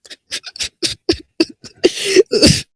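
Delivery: helpless high-pitched laughter; high squealing laugh. Real, not acted. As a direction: shriek with laughter. Laughing while reading.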